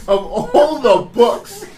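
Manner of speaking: half laughing, half crying, slow; laughing disbelief. Real, not acted.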